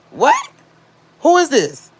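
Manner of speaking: squeaked what, then high demand; imitating her own alarm on the phone; retold shocked reaction. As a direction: voice leaps sky-high in disbelief. Shocked by detective's phone threat.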